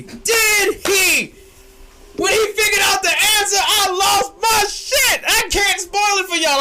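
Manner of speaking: reliving the twist, hyped; excited shout. Real, not acted. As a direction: yell like it just happened. Hyped recalling the reveal.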